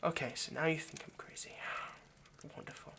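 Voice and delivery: whispered, dry resignation; sarcastic whisper. Acted. Vampire whispers sarcastically after the captive calls him crazy. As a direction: mutter it to yourself, deflated.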